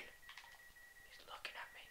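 slow tense whisper; slow frightened whisper. Real, not acted. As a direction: breathe the words, frozen in place. Mob staring at him.

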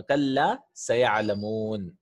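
slow, deliberate recitation of "kalla sayalamun" with a long double l. Shown as a demonstration. Teacher reciting to students, slow and deliberate. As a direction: draw out "kalla sayalamun" like a Quran reading. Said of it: "reciting the verse for students".

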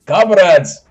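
loud, high Russian rallying call; rally the comrades in a mock Russian accent. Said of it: "I cannot do a Russian accent, but I will try".

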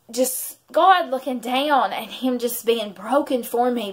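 ordinary talk, nothing marked

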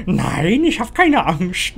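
high, drawn-out, unconvincing denial; panicky denial. Real, not acted. Player insisting there is no fear, panicky denial. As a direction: wail it slowly, obviously scared.

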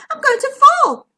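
shrieked, high bird-like panic; squawking. A narrator's reading. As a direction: squawk it loudly in terror. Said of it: "squawked Bertie".

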